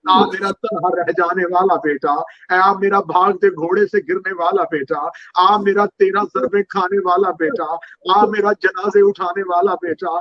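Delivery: rising, keening calls that climb line by line; mournful lament. Acted. Preacher voicing the grieving mother to the mourners, keening.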